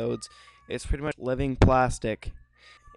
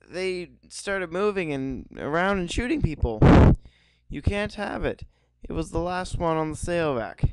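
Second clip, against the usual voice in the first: much higher, put-on woman's voice; girly voice; 7 semitones higher than usual. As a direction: play the heroine, high. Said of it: "I don't have a very girly voice".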